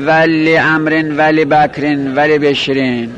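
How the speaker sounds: slow "le" at the start of لامرن, لبکرن, لبشرن; measured list with "le" on لبشرن and the others; kasra on lam. Shown as a demonstration. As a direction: recite لامرن as "le-amren", likewise لبکرن. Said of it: "اصل در لام جاره این است که کسره داشته باشه".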